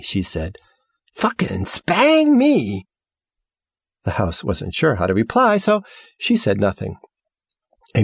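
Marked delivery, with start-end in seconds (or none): 1.2-2.9 high, slow, drawn-out startled curse; shocked outburst. Acted. Girl sees the house, cursing in high slow shock. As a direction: stretch it out in high disbelief.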